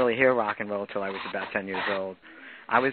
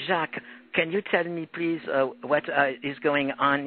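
imitating a French accent, a bit higher; brother's heavy French accent; 3 semitones higher than usual. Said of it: "he's got that French accent".

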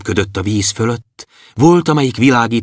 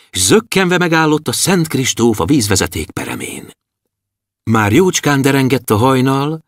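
ordinary delivery, nothing marked

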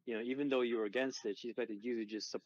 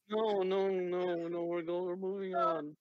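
insistent protest; 7 semitones higher than usual. Real, not acted. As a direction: cut them off, pitched up. Refusing a redo of line.

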